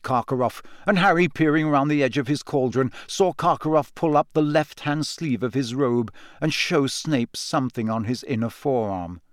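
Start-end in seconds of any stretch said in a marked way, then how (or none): none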